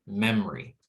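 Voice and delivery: "memory" in two syllables, MEM-ree; middle vowel dropped. Shown as a demonstration. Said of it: "instead of memory".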